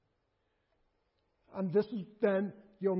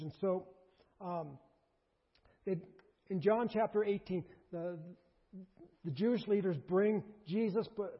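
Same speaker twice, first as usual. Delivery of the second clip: ordinary delivery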